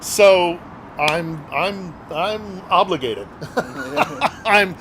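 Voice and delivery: a real laugh breaks into the words. Real, not acted. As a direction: laugh at his own stubborn promise, half embarrassed. Laughs about his own promise.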